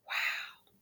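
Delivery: breathless whispered wow; just breathe the word out in disbelief. Real, not acted. To viewers, a stunned whispered reaction.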